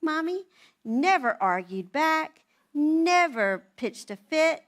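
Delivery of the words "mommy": put-on high, sweet-as-pie child's voice; agreeable little daughter. Daughter answering mom's order to clean up, high childlike agreement. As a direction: imitate an obedient little girl, pitched high. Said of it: "and she'd be like".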